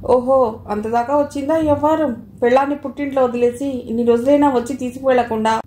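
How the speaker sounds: usual speaking voice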